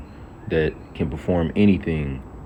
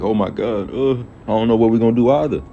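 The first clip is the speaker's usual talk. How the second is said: mock panic; 5 semitones higher, 3 dB louder than usual. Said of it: "that you should be like".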